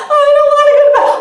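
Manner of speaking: high, loud, fast mock whine; whiny kid. Crying child whining to a parent about bedtime. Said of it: "if your kid is crying".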